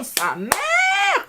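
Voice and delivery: high-pitched playful outburst; playful exclamation. Pretend. French reviewer reacting to sablé breton, high playful exclamation. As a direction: shoot the pitch way up.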